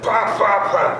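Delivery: punchy mimicked pop sounds; popping wheels. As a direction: mimic the tyres bouncing.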